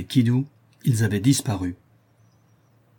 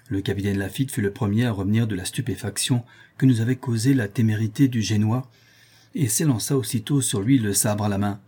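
ordinary delivery

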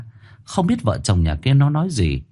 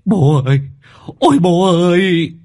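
louder and higher, an anguished cry while running; 9 semitones higher, 6 dB louder than usual. A narrator's reading. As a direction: wail for the dying father. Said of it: "vừa chạy vừa kêu". (vừa chạy vừa kêu thảm thiết)

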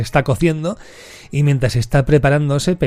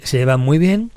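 ordinary talk, nothing marked

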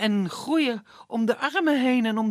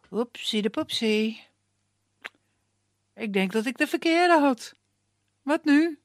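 mischievous sprite; 3 semitones higher than usual. Acted. Puck's mistake voiced.

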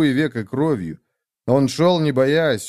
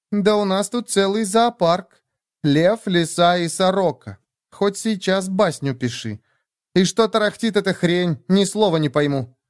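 put-on higher-pitched sardonic young woman; brash, mocking Nastya; 7 semitones higher than usual. Nastya sizes up the strangers, mocking. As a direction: scoff at the company in a high voice.

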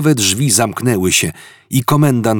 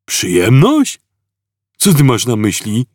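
puzzled guard; 3 semitones higher, 5 dB louder than usual. A narrator's reading. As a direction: sound dim and baffled.

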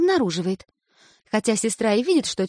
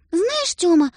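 higher, coaxing sister; wheedling big sister; ingratiating coax; 9 semitones higher, 3 dB louder, slower than usual. A narrator's reading. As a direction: sweet-talk her little brother. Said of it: "говорит она как можно вкрадчивее".

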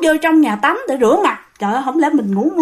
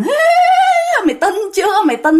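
higher taunting ghost voice; mocking ghost; 9 semitones higher, faster than usual. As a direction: sneer at him. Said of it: "mà vừa kêu rằng".

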